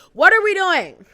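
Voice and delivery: high, loud exclamation at the cat; sudden loud call to the cat; exasperated outburst. Real, not acted. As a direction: yelp it at the pet. Scolding the cat on camera.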